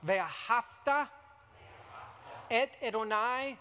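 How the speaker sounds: measured liturgical recitation in Hebrew; prayer leading. Shown as a demonstration. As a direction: lead the Shema line by line. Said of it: "You can repeat after me".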